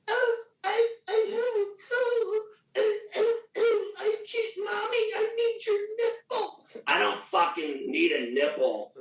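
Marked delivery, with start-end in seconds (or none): 0.1-6.7 very high, tearful mock-baby whimper; whiny sick baby. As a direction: imitate a pathetic crybaby with a cold. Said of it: "some people are like".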